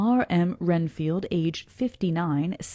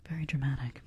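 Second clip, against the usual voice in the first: whispered aside; 4 semitones lower, 8 dB quieter, faster than usual. Real, not acted. Whispered aside about the text.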